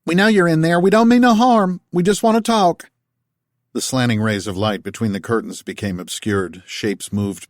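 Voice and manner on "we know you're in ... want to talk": higher, louder southern holler; down-home call. A narrator's reading. Martha calling to Tal through the door, down-home accent.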